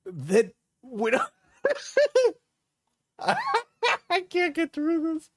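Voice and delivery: breaking into giggles mid-line; can't finish for laughing. Real, not acted.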